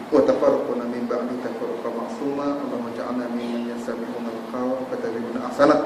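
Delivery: soft, subdued Arabic supplication; closing prayer. Real, not acted. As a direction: recite the closing doa quietly. (doa penutup dibaca perlahan)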